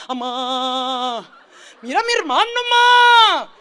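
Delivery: put-on a kid wailing to his mother about his brother; whiny kid tattling. Niños acusándose con la mamá. (niño llorón acusando a su hermano con la mamá, agudo y fuerte)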